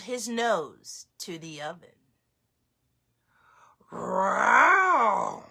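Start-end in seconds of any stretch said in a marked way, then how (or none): 3.9-5.4 bear roar. Acted. Bear answering Baba Brena, roaring.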